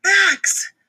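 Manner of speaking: high loud yell. Acted. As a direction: shout the instruction. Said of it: "yelled Dakota".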